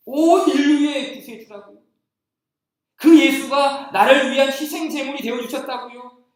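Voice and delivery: loud, high preaching cry; rising pulpit fervor; fervent preaching. Acted. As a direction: proclaim it to the whole church. Preaching climax.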